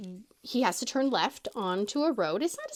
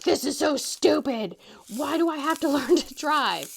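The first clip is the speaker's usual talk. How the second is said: fed-up whine; 4 semitones higher, 4 dB louder than usual. Retold or imitated. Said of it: "he's over it".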